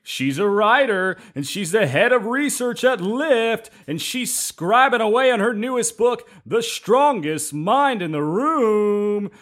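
high sing-song showman intro; sing-song like a lounge emcee; ringmaster. Acted. Musical guest intro.

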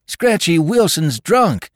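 high, loud alarmed cry; cried out. Acted. Young man at the door warns the saloon, crying out. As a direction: burst in shouting the warning. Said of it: "He cried".

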